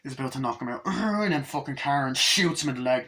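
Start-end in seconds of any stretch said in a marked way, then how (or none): 0.9-1.2 high imitated effort roar; attacking yell. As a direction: roar while about to strike. Imitates Chucky swinging the bat.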